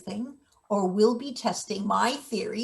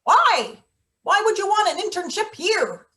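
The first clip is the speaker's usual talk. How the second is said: incredulous; 9 semitones higher than usual. Acted. Boss incredulous at intern.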